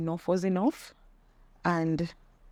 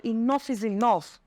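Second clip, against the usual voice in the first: mad; 5 semitones higher than usual. Retold or imitated. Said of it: "I was so mad".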